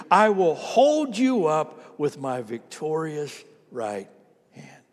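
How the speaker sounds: very slow, measured verse read aloud; solemn, drawn-out recitation; congregational scripture reading. Real, not acted. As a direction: lead the room phrase by phrase. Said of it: "Would you read this out loud with me?".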